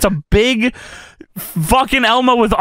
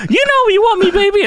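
very high puppet squeal; Elmo; 11 semitones higher, faster than usual. Acted. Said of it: "Elmo says".

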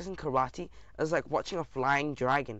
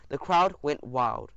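usual speaking voice

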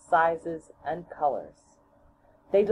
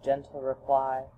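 ordinary delivery, nothing marked

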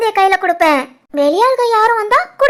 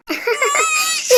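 amused laugh; 6 semitones higher, 5 dB quieter than usual. Real, not acted. Aunt laughs while the newborn wails.

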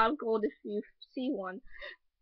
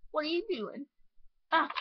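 ordinary talk, nothing marked

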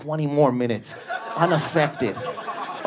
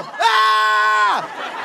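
high startled scream; 4 dB louder than usual. Retold or imitated. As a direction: yelp in fright. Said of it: "I go".

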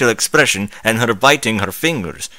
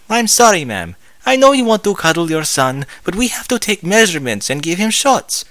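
nurse voice; 8 semitones higher than usual. Acted. Nurse voiced in read story.

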